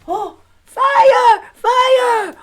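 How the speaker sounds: high, loud alarmed shout; panicked cry of fire. Acted. Someone behind the village raising the alarm, panicked shout. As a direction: scream the warning in panic. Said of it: "we heard the shouts".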